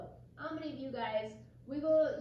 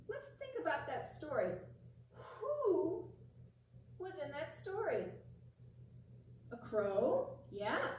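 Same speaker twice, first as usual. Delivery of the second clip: ordinary delivery, nothing marked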